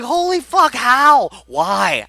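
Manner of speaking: high, loud excited outburst, fading; imitated excitement. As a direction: mimic a stunned fan yelling at the TV. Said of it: "she's so excited".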